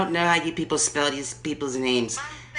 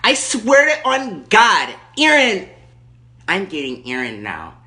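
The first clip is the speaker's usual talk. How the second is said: Erkenbacher. Acted. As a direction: play the furious entitled lady on the phone. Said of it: "Erkenbacher calling back".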